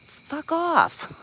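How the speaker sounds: a tiny mock voice telling someone to get lost; squeaky mock retort. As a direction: brush them off in a cartoonish voice. Acted retort to someone controlling her.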